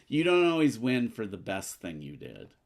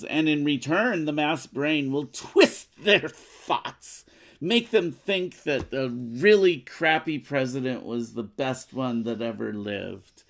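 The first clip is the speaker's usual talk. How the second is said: telenovela villain; 3 semitones higher, 6 dB louder than usual. Acted. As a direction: reveal the evil plan with relish. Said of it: "She just sounded like a telenovela villain".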